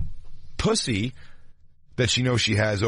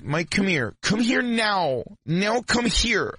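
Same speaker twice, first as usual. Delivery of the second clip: high, slow, drawn-out come here; high slow imperious summons to Mike; commanding call; 10 semitones higher, slower than usual. Retold or imitated. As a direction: order him over like a pet. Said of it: "she's like".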